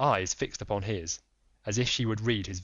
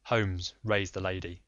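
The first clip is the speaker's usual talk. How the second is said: usual speaking voice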